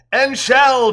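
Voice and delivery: loud, high, triumphant declaration; grand declaration. Acted. Joe answering Courtney before he sings, loud high declaration. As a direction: declare it boldly before a song. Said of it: "Joe declares".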